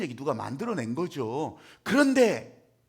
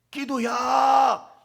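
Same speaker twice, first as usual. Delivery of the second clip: slow, louder, higher drawn-out yell; mock nagging shout; 5 semitones higher, 8 dB louder, much slower than usual. Idle onlooker ordering others to pray, slow mock yell. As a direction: bark it like a bossy onlooker.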